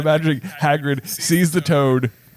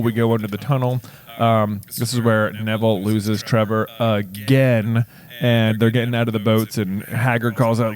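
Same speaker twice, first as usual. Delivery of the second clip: ordinary delivery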